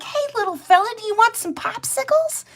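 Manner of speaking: put-on very high, sugary voice to the baby skunk; high, cooing baby talk; sweet baby talk. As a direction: coo at the little skunk. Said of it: "I'm like".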